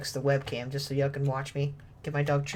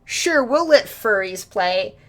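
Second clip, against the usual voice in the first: slow, louder, falsely generous; mock sarcastic quote; 5 semitones higher, 10 dB louder, slower than usual. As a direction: drawl it out like a grudging company. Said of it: "They're like".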